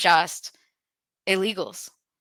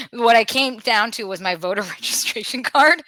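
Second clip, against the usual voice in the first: ordinary talk, nothing marked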